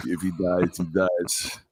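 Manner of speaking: deep deadpan Austrian accent playing the Russian boxer; Arnold doing a Russian. Quoting a movie villain for cohosts, in a low Austrian accent. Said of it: "I'm going to do Austrian for the Russ".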